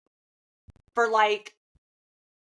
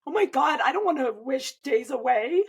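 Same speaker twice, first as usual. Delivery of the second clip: dismayed outburst; 4 semitones higher than usual. Retold or imitated. Mother to therapist, recalling her horror, dismayed. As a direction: recoil from her own thought.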